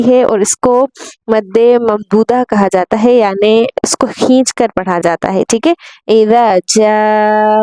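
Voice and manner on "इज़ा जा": जा drawn out for four to five counts; इज़ा जा with a long held aa; long madd stretch. Shown as a demonstration. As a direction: stretch the vowel of जा long and slow. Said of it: "चार से पाँच हरूफ के बराबर हम खींचेंगे".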